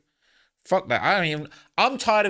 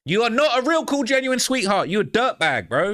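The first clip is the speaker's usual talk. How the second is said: scornful yell; 5 semitones higher than usual. Real, not acted. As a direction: shout her down with contempt. Reactor yells at the mother.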